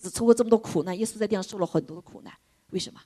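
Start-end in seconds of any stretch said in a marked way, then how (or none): none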